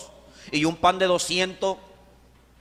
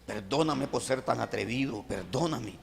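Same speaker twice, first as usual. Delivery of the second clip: much softer, lower, humble asking forgiveness; inner plea; 7 semitones lower, 11 dB quieter than usual. Retold or imitated. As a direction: murmur it inwardly, ashamed. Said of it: "mi mente le decía".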